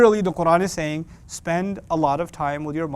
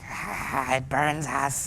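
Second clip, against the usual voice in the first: lightly voiced creepy creature, softer; possessed creature; 3 semitones lower, 6 dB quieter, much slower than usual. Teen mocked as a possessed creature. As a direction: hiss it like a Gollum-like thing. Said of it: "possessed by the thing from The Ring".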